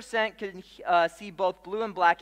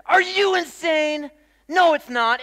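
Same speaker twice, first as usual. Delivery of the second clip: high, loud, slow outraged yell; retold yell; 10 semitones higher, 10 dB louder, slower than usual. Coworker shouting back at the secretary, outraged. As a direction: shout down the other side. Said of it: "someone else comes in and says".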